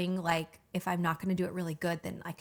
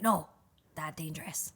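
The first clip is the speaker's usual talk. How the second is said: caveman voice; 2 semitones lower than usual. Retold or imitated. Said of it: "that caveman voice".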